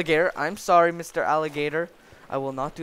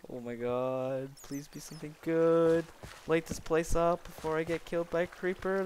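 ordinary talk

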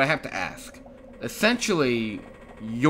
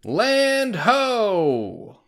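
loud, high sailor's cry; calling out; 9 semitones higher, 5 dB louder than usual. Acted. Link's companion announcing land, loud call. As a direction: call it from the crow's nest.

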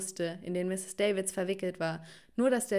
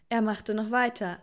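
ordinary delivery

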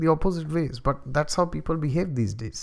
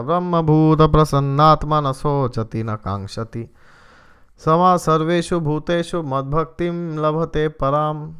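louder, chanted Sanskrit verse; verse recitation; 6 dB louder than usual. Acted. The preacher quoting Krishna in the Gita, chanted recitation. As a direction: intone the shloka with weight.

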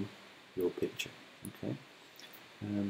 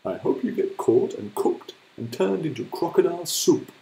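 cross hippo; 6 semitones higher, 6 dB louder than usual. Acted. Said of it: "cried Humpy Rumpy".

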